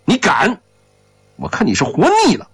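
high-pitched angry challenge, then rapid threat; outraged threat. A narrator's reading. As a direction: flare up at the boy's nerve. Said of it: "霍振西眼睛一瞪". (瞪眼怒斥，又高又快)

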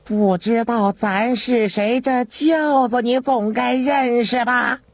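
high, slow, imperious voice; drawn-out, challenging eunuch tone; haughty eunuch. A narrator's reading. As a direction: pull rank in a thin high register.

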